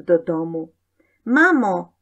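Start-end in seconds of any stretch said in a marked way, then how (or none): 1.2-1.9 loud, high call for mum; child hailing his mother. A narrator's reading. Boy calls mother from the doorway, loud and high. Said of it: "woła od progu".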